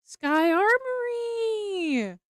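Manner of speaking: high, slow, drawn-out delighted cry; thrilled card-pull squeal; excited. Real, not acted. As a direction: stretch the name out in delight. Excited reveal of a pulled card.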